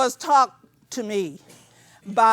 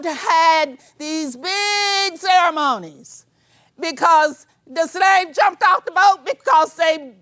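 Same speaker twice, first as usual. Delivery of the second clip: loud, raised preaching voice, pitch up; shouting; 4 semitones higher, 4 dB louder than usual. Real, not acted. Speaker shouting her pride in ancestors to the audience, loud. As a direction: shout it out to the whole hall. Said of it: "I wouldn't be here shouting at you today".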